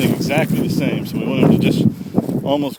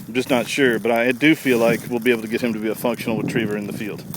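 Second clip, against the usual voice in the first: ordinary delivery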